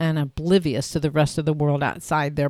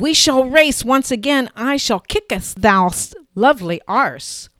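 higher and louder, hammed-up lady's challenge; mock courtly challenge; 8 semitones higher, 6 dB louder than usual. Woman challenges her boyfriend to a ski race, mock courtly and loud. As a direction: throw down the gauntlet like a mock noblewoman.

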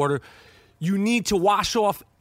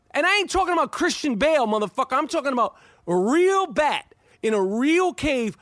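normal talking voice

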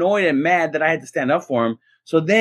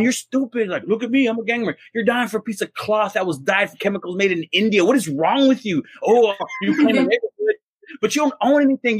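higher, mocking tirade at a gang member; furious rant; 8 semitones higher than usual. Student losing it at a gang member in class, higher ranting insults. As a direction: tear into him, pitch up and fast. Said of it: "I remember going".

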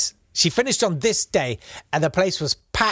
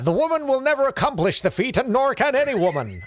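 put-on high, hammy old-time American announcer; American theatrical voice; 9 semitones higher than usual. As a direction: ham it up like a stage actor. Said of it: "I'm trying to do that American theatrical voice".

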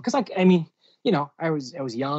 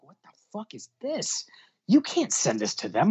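boss scolding; 6 semitones higher than usual. Retold or imitated. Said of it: "And she's like".

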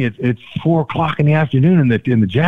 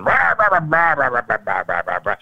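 mimicked foreign ranting. Manager going off at player through interpreter, mock ranting. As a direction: imitate a barked stream of unintelligible Japanese. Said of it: "talking in Japanese".

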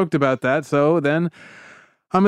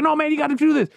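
insistent fan; 13 semitones higher, much faster than usual. Retold or imitated. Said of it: "He's like".

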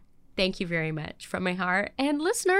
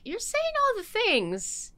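high, slow, sugary put-on voice; mock fake sincerity; 9 semitones higher, slower than usual. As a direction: parody a phoney person's sweet talk. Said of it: "you're like".

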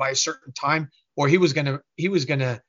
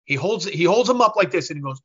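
ordinary delivery

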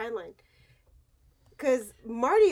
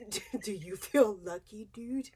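low, soft tough-guy impression; Clint Eastwood; 5 semitones lower, 6 dB quieter than usual. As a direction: go low and near-whispered, slow at the end. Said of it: "Clint Eastwood would say it".

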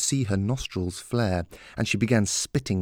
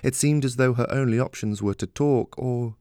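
ordinary delivery

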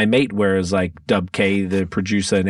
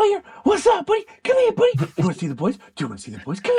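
very high squeaky talk to a pet; dog voice; faster than usual. Real, not acted. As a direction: coo up high at your puppy. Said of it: "Everyone heard your dog voice now".

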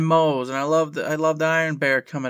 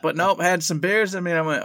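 ordinary delivery

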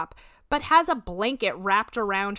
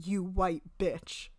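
slowly repeating the insult, softer and disapproving; slow disapproving quote; 7 dB quieter, much slower than usual. Retold or imitated. [name] reads the insult back to Larissa, slow and disapproving. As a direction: say each word slowly, as if holding it up as evidence. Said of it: "did say was extremely ugly and racist when you said".

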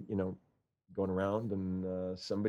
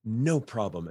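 normal talking voice

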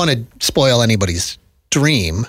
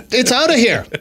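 higher announcer home run call; 11 semitones higher than usual. Pretend. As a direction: call a homer on air.

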